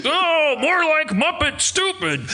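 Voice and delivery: put-on high, cackling old-man heckle; Statler and Waldorf heckle. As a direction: heckle from the balcony like a grumpy Muppet. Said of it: "just going like".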